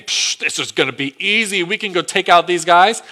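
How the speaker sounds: scoffing, cocky; dismissive scoff. Retold or imitated. Israelites scoffing at the small town, cocky. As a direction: wave off the enemy with a psh. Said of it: "the Israelites are thinking".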